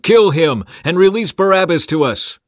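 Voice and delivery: loud, high mob demand; crowd shout. A narrator's reading. Roaring crowd demanding of Pilate, shout. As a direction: shout it as one voice. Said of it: "with one voice they shouted".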